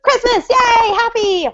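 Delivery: put-on high, gleeful child-like cheer; excited little kid. Steven, an eleven-year-old, thrilled about Christmas, excited. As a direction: play a thrilled small child. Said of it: "Steven would be really excited".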